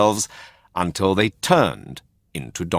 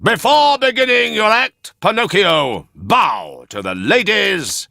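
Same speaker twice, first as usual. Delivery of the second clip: slow, high, loud showman's call; ringmaster announcement; 11 semitones higher, 4 dB louder than usual. Acted. Ringmaster presenting the donkey to the audience, slow and loud. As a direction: announce to the whole big top.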